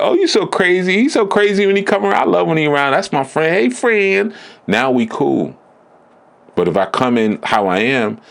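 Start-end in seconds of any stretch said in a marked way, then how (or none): 0.0-4.4 high, delighted mock gushing; gushing friend. A woman welcoming the flamboyant version of him, in a high gushing voice. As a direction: imitate someone charmed and fawning. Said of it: "then he'd be like".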